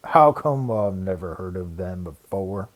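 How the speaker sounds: slow, low puzzled workman; baffled Larry. Acted. Larry puzzles over the gremlins, slow and low. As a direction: wonder aloud slowly.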